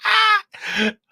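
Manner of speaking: high, loud screaming laugh; a real shriek of laughter; howling with laughter. Real, not acted. Bursts out laughing.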